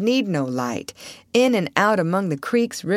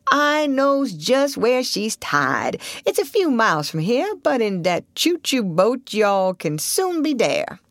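slow, higher dialect drawl; old Southern boatman, assured; 5 semitones higher than usual. Acted. Old boatman promising they will soon arrive, slow drawl. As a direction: draw it out like an assured old local.

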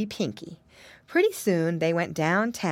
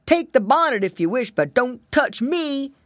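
loud wolf voice, pitched up in alarm; growled warning; 6 semitones higher, 5 dB louder than usual. A narrator's reading. Wolf bargains with the angry bees, growling. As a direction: growl at them to keep away. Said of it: "growled the wolf".

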